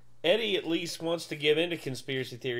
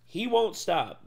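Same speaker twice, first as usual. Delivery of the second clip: ordinary delivery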